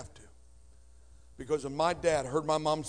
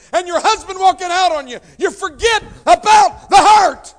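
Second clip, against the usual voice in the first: builds to a soaring, shouted climax, much higher and louder; preaching at full force; 10 semitones higher, 14 dB louder than usual. Acted. Preacher building the list of hurts into a shout. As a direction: preach it up to a roaring peak.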